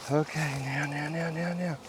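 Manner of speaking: quiet soothing now now now; coaxing softly. Real, not acted. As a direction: hush the bees gently.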